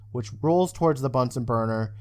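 ordinary talk

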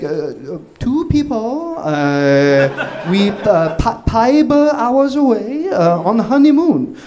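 hammed-up Korean accent, slow and broken. Wife calling the hotel, faking a thick Korean accent. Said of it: "do your, like, thickest, you know, Korean accent".

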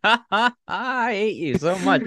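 laughing through playful protest; high, slow laughing words. Real, not acted. Teased, laughing back at friend.

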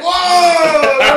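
loud high laughing whoa; everybody shouting whoa at the trick; amazed crowd. Retold or imitated.

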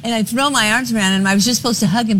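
ordinary talk

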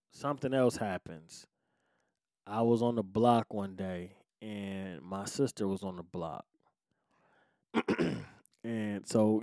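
ordinary talk

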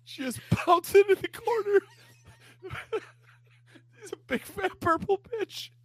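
very high squeaky cartoon voice; bouncy Grimace voice. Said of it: "Boing, boing".

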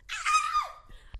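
high squealing laugh; excited squeal laugh. Real, not acted. Shocked at own big news.

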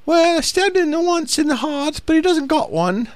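very high, hammed-up British lady voice; matter-of-fact British wife, imitated in falsetto; chill British lady. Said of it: "can't do the real elegant, uh, British voice".

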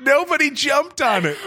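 shouted teasing emphasis; mock outrage. Real, not acted. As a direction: crow it at her, delighted. Mocking the failed prank.

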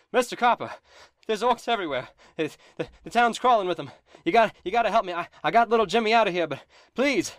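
high, loud, frantic begging for help; panicked plea. Acted. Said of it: "Barthen says".

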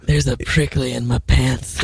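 low radio host voice; husky late-night radio delivery; sexy radio voice. Acted. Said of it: "This is our sexy radio voices".

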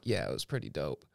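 very low, hushed cop imitation; nonchalant cop. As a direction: drop right down, casual and understated. Retelling the cop's casual boast.

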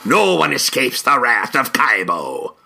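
slow, gruff-styled pirate threat. Retold or imitated. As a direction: growl it like a cartoon pirate. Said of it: "like, a standard pirate voice".

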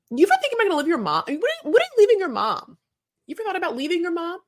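high, fast, put-on whiny asking voice; mock nagging question. Podcaster mimicking the bride quizzing her fiancé, high and fast. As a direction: fire it off like a nosy bride. Mocking how she'd ask him.

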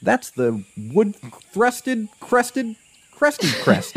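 high, drawn-out mock bird-expert voice; hammed-up birder reeling off a species name; mimicking an expert birder. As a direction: slowly savor the fancy bird name, like a know-it-all. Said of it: "And he's like".

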